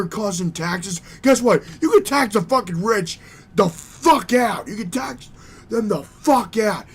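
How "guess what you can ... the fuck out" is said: loud, furious shout; real yelling. Real, not acted. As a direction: yell it at the rich. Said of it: "I'm yelling up a storm here".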